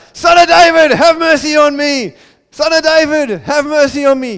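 full-voiced, very high desperate shout; loud, high cry, holding nothing back. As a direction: yell for mercy over the whole crowd. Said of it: "He just shouted louder".

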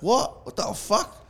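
imitating his own shock as the guy grabs him; shocked disbelief.

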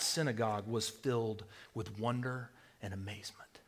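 whispered amazement; awed whisper. Real, not acted. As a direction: whisper of wonder. Describing the crowd's awe.

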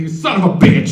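half-hearted imitation of a yelled insult; mild mock yell. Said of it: "He just screams it out".

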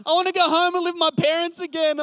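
wife in tears asking why he's so angry, mock sobbing; mock tearful wife. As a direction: play his wife pleading through tears. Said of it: "she starts bursting into tears".

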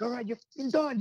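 high-pitched mock anger with an accent; retold boss losing his temper; imitated angry captain. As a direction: cut the crew off like an angry dad. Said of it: "get angry, that's when his accent would come out".

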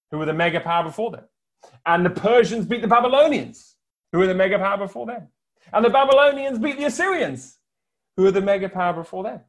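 quick repeated refrain that drives the list forward; fast repeated refrain; rhythmic refrain. Shown as a demonstration. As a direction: rattle off the refrain each time.

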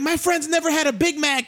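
high-pitched mimicry of his wife; comic high-voiced retold complaint; imitated wife. Imitates wife yelling from other car.

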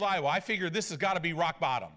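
ordinary talk

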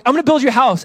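high, loud playful announcement; mock proclamation. Preacher playing God promising David a house, loud and high. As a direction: announce it big, voice lifted. Comic retelling of God's promise.

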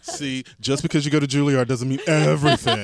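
loud playful teasing; mock scolding tease. Real, not acted. Teasing about Juilliard.

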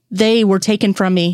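ordinary delivery

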